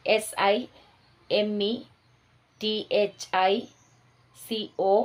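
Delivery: slow, spaced-out teacher dictation with long pauses. Shown as a demonstration. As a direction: spell each word out letter by letter, pausing so students can write. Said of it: "ഈ പ്രാവശ്യം ഞാൻ നിർത്തി നിർത്തി തന്നെയാണ് പറയുന്നത്". (നിർത്തി നിർത്തി പറയുന്ന ഡിക്റ്റേഷൻ)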